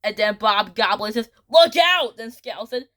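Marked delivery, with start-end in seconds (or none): 1.5-2.2 high, loud acted alarm; shouted warning. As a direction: call out a sudden warning. Bob Goblin shouts a warning.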